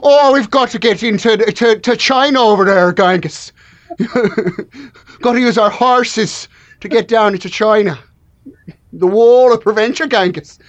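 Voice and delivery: mock Irish accent. Irish people playing Mongolians to Genghis, mock Irish accent. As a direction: do an Irish actor badly cast as a Mongolian, Irish accent. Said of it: "literal Irish people being like".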